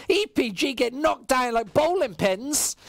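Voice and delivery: esports caster call; live play-by-play. Acted. Caster calling the round for stream chat, live play-by-play. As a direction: call each frag to the viewers.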